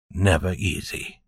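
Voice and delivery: hushed reflective repeat; soft echo. A narrator's reading. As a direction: let "Never easy." fall away quietly.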